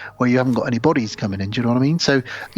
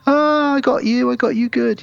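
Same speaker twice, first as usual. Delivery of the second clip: playful dad's gotcha; 12 semitones higher than usual. Retold or imitated. Dad teasing the scared girlfriend, high gleeful gotcha. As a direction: crow like a prankster dad who got you.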